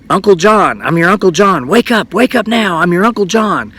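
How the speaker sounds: high, insistent imitation of an angry old man; Uncle John, mock yelling. Dream uncle naming himself to wake her, insistent mock yell. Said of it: "mean old man yelling at her".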